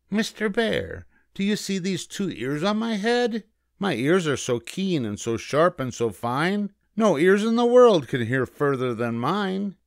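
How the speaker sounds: high-pitched, boastful rabbit voice; cocky little rabbit; bragging rabbit. A narrator's reading. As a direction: show off to the bear, pitched high. Rabbit brags to bear.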